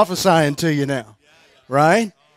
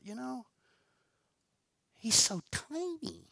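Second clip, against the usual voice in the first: whispered thought; 7 semitones higher, 17 dB quieter than usual. Retold or imitated. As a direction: breathe it out like a secret. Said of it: "and I thought".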